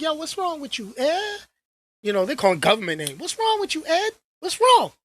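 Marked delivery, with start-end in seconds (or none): 0.0-1.4 mock concerned fans. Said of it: "people are gonna be like". 3.2-4.9 mock concerned fans. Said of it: "people are gonna be like".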